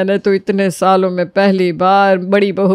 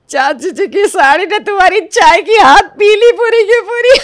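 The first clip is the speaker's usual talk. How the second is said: giggly teasing; 10 semitones higher, 4 dB louder than usual. Acted. Teasing the tea spill.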